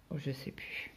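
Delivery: soft voiceless mutter to herself; muttered whisper of forgetting. Real, not acted. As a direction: whisper it, giving up on the name. Forgets the show's title.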